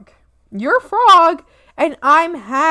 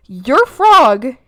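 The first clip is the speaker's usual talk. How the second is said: ordinary delivery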